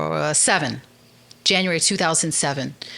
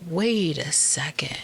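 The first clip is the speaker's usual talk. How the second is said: stunned whisper; 3 dB quieter, slower than usual. Retold or imitated. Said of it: "And I'm like".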